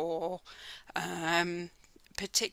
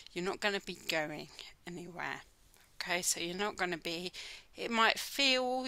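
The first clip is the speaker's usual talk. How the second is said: ordinary talk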